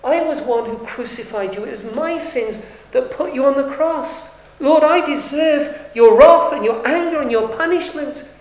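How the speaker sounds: confessing to the Lord, building to a loud cry; a sinner confessing before the Lord, swelling to a loud plea; pleading confession. Acted. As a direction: pour out the guilt, rising to a full-voiced plea. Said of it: "come to fall before him and say".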